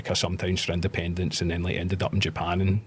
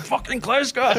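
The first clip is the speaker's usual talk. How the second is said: proud retort; 4 dB louder than usual. Retold or imitated. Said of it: "I was like".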